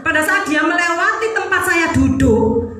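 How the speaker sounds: normal talking voice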